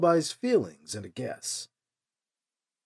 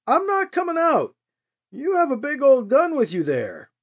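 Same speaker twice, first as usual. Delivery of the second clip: higher, louder refusal called down; calling out; 10 semitones higher, 3 dB louder than usual. A narrator's reading. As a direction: shout a nervous no across distance. Alibi calling down trail.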